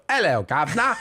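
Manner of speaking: a touch of a put-on British accent; lightly voiced cockney hello; mock English greeting. Comedian practising his greeting for the king. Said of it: "I'm gonna go".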